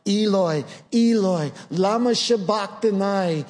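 a preacher voicing a forsaken cry; anguished cry. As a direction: cry it out like an abandoned man. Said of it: "He cried out".

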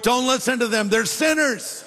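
high-pitched comic objection; mock pleading protest. Paul begs the Lord to ignore praying believers, mock protest. Acting Paul's plea against being resurrected.

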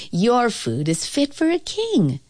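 high, proclaiming king's voice; crying out. A narrator's reading. King to the cook, praising dinner, crying out. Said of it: "he cried".

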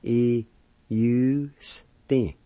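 "i, u, s, ti" in separate sounds; broken-up teaching take of "i, u, s, ti"; syllable-by-syllable demo. Shown as a demonstration. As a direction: sound out "i, u, s, ti" with pauses. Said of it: "Second part".

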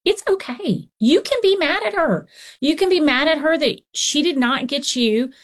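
put-on high sing-song reassurance to an upset kid; soothing a mad child. Counselor gently validating a child mad at mommy, high and soothing. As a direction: coax an angry little one to name his feeling. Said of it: "But I tell children all the time".

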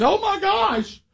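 high squealing mock scream of oh my gosh; wife's shrill panicked scream, imitated. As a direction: shriek it like the wife spotting blood. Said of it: "she screams".

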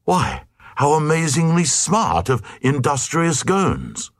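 mock-impressed crowd; lightly hammed-up praise; praising cry. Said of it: "Cried the Glugs praisingly".

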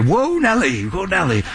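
playful mock "whoa" to a horse; mock horse call. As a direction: lightly imitate a rider reining in a horse. Imitates guest calling to his horse.

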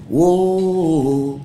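a drawn-out stage "Whoa" sung into the crowd; singer's mic call. Retold or imitated. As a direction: call out like a showman working the audience.

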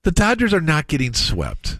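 high-pitched indignant denial; indignant insistence. Real, not acted. Co-host rejects talk of Dodgers getting swept, indignant. Playful argument over a sweep.

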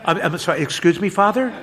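did I hear that right; confused boy. Acted. Boy checking what the priest just said, high and puzzled.

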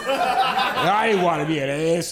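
slow, meek mock voice; passive friend. Mocking passive friend's excuse, slow timid voice. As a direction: say it timidly, slowly. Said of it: "He went".

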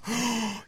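sharp excited gasp; a quick delighted intake of breath. Real, not acted. Host gasps over the music video, sharp delighted gasp.

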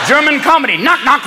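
ordinary delivery

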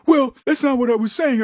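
mocking a flustered leftist backpedalling; mock defensive liberal. A leftist who got yelled at, in a mock defensive whine. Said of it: "they're like".

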